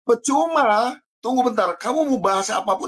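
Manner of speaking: ordinary talk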